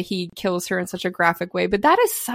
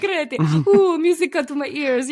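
hammed-up Italian, higher, laughing through it; mock Italian; 6 semitones higher than usual. Imitating Landa's showy Italian, laughing and pitched up. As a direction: relish the Italian, mocking. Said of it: "that's like what he says".

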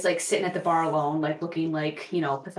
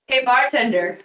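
louder, higher call across the bar; 5 semitones higher, 7 dB louder, slower than usual. Acted. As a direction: get the bartender's attention. Calling the bartender over.

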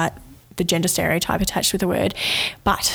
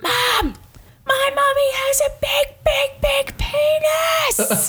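put-on much higher child's voice, the last bigs drawn out very slowly; little boy's proud announcement; much slower than usual. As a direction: play the toddler proudly shouting it to the park. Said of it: "declares this at the top of his lungs".

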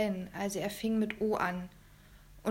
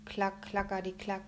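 usual speaking voice

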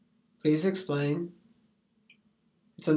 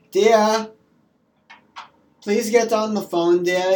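put-on higher and louder nagging old woman; fussy old lady; 5 semitones higher, 9 dB louder than usual. Old lady calling dear to the phone, higher and loud. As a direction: call out like a grandma chasing her dear.

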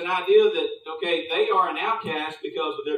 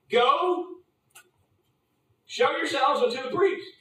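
commanding Jesus; 6 semitones higher than usual. Retold or imitated. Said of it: "he said unto them".

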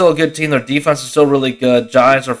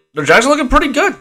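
much higher, eager and upbeat; excited; 13 semitones higher, faster than usual. Real, not acted. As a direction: lift it with real excitement. Repeats phrase with more energy.

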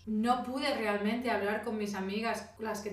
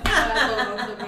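laughing mock accent; 11 dB louder, slower than usual. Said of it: "¿por qué hablas así cuando me invitas?".

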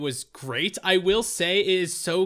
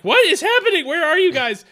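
high, loud confused outburst; baffled exclaim; 12 semitones higher, 9 dB louder than usual. Retold or imitated. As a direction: shout out the confusion, pitched way up. Retelling own confusion at the podcast.

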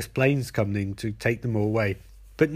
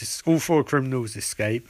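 normal talking voice

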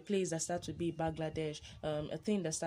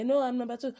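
protesting classmate; 8 semitones higher, 7 dB louder, faster than usual. Retold or imitated. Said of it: "That person will say".

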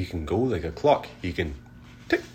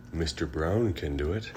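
ordinary delivery

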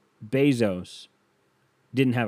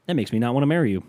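ordinary talk, nothing marked

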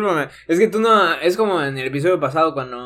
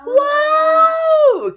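amazed fans; 9 dB louder than usual. Retold or imitated. Imitating amazed American fans.

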